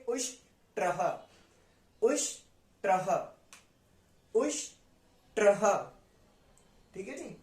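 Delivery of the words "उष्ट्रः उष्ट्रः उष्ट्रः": slow "उष्ट्रः", split into ush and traha; syllable-split pronunciation demo. Shown as a demonstration. Teacher showing how it is pronounced, "उष्ट्रः" in two slow parts. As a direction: hold "उष्" in "उष्ट्रः", pause, then release tra-ha. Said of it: "कैसे करेंगे".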